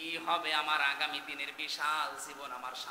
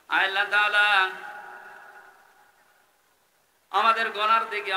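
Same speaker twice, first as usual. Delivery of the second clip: impassioned prayer cry; 4 semitones higher, 9 dB louder than usual. Real, not acted. Preacher pleads with God for the sinners, loud high cry.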